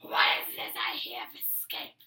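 very low, drawn-out villain voice; slow, loud low rumble of suspicion; menacing villain. Acted. Villain catches prisoners plotting escape, low and menacing.

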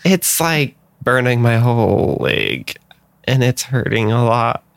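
high, hurting little character voice; hurt creature character. Acted. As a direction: ask for help through acted pain. Plays the hurt Milea.

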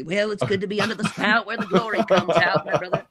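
mild hammed-up revival preacher; imitating preacher dad. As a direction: preach it like your father in the pulpit. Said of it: "I got a little bit of my dad in me".

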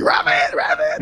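put-on high shout of an eager kid; excited kid shout. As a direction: yell it like a kid on a bike. Kid shouting at passing BMWs.

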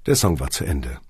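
plain reading voice

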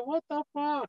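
high, shocked "what the fuck"; startled outburst. Retold or imitated. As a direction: blurt it out, jolted awake. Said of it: "I said".

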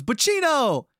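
high, loud, taunting calls; high loud needling drunk voice; drunk owner calling. Retold or imitated. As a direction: imitate a drunk guy teasing someone awake. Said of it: "He goes".

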